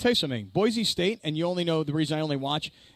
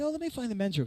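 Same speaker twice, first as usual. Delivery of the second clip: feigned casualness; 5 semitones higher, 5 dB quieter, faster than usual. Acted. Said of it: "I act like I'm, like, looking at the bar".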